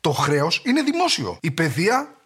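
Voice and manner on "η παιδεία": ordinary talk, nothing marked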